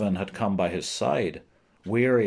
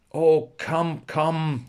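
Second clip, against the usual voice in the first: mild narrated shout, pitched higher; raised-pitch call, only lightly shouted; 5 semitones higher, 3 dB louder, much slower than usual. A narrator's reading. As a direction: call them over in a lifted voice. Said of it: "shouted Ned".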